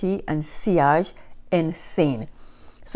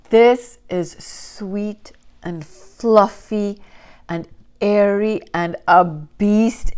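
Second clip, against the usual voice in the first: very slow, drawn-out praise; savoring; much slower than usual. Real, not acted. Describing the scent to viewers, slow and savoring. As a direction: linger on every word.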